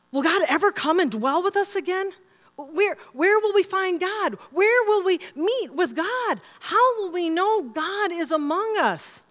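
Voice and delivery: high-pitched, pleading questions, slowing down; desperate questioning. Acted. People of God left asking after exile, acted desperate plea. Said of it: "They were desperate".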